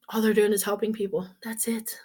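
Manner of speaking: fast hushed whisper; quick conspiratorial whisper. Real, not acted. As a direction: let it slip out as a rapid whispered aside. Aside about earning money.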